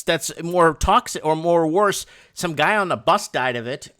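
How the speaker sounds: usual speaking voice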